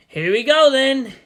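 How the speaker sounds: loud, cheerful call; rally yourself with a laughing shout; shout with a chuckle. A narrator's reading. Boy sets off on his donkey with a cheerful shout. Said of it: "he shouted with a slight chuckle".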